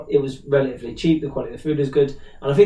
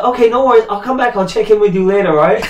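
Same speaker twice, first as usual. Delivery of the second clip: cheery dealer; 7 semitones higher, 6 dB louder than usual. Retold or imitated. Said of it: "And the guy's like".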